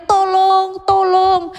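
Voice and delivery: a feeble mock cry of a dying man; weak cry for help. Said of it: "dia hanya bilang berteriak". (teriakan minta tolong yang lemah)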